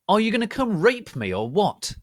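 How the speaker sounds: high, loud accusing yell. A narrator's reading. Narrator confronting Lupin, loud yell. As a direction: hurl it at him. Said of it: "I yelled".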